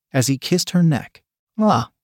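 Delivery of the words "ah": short high squeal. A narrator's reading. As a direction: let out a quick squeal. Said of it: "Lily squealed".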